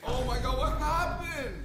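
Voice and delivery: high, slow, drawn-out shock; shocked squeal. Real, not acted. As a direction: squeal it out in disbelief. Shocked at ruined donuts.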